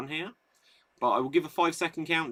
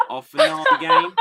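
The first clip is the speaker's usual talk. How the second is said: high, loud announcement breaking into laughter; giggling game-show reveal; laughing announcement; 11 dB louder, faster than usual. Real, not acted. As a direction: announce the finale while cracking up. Host announcing the finale.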